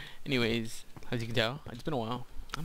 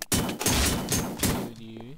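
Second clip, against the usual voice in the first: taunting; 5 dB louder, much slower than usual. Real, not acted.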